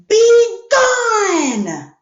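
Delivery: a furious bear shouting at a pesky mouse to go; bear's roar. Shown as a demonstration. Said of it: "I'm not gonna read it in a regular voice".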